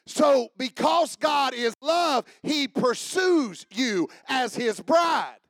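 shouted, slowed preaching; preaching at full force. Acted. Preacher to congregation, shouting the gospel. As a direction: preach it loud and slow to the church.

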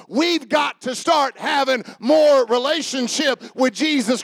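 loud, driving preacher's cry; full-force preaching. Acted. Pastor urging the church to get serious, full-force preaching. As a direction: preach it hard to the congregation.

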